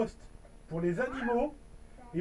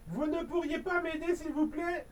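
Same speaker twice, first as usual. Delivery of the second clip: little hedgehog pleading; 5 semitones higher, 6 dB quieter than usual. Acted. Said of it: "lui demande un petit hérisson".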